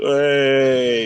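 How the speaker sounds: loud, drawn-out high "hey"; crowd cheer. Shown as a demonstration. Brit demonstrating the pub reflex when glass breaks, drawn-out cheer. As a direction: hold it out like a whole bar cheering. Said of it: "everyone just goes".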